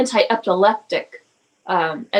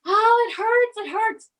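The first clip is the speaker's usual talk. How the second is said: high, crying child's voice, imitating her son; son in pain; 12 semitones higher than usual. Mother imitating her sick son's painful cries, high child voice. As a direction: mimic a little boy wailing that it hurts. He started screaming.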